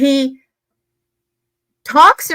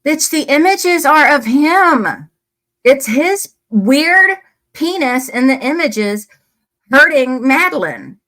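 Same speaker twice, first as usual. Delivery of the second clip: real anger, loud and drawn out; slow, raised-voice indignation; mad; 4 semitones higher, 4 dB louder, slower than usual. Real, not acted. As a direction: hit each phrase hard and high, outraged. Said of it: "That makes me mad".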